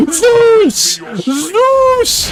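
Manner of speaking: high, drawn-out cry of a name; urgent calling out. Real, not acted. Gamer calling on Zeus mid-fight, high drawn-out cry. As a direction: call the name high, stretched out.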